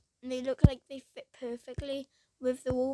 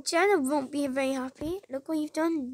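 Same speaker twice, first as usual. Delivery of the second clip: ordinary talk, nothing marked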